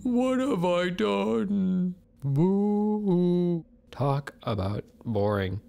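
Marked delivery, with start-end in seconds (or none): 0.0-3.7 mock lament. Eagle's mock lament retold.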